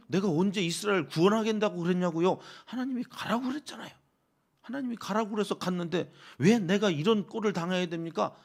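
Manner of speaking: high-pitched indignant protest; aggrieved Moses complaining. Acted. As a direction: argue back at God as the wronged one. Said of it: "하소연을 하는 모세의 마음이".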